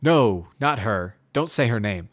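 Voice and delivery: loud and slow, harshly forbidding. Acted. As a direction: snap it slowly and hard, a warning. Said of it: "I say harshly".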